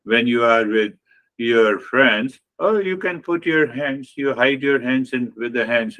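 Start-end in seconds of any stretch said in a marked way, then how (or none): none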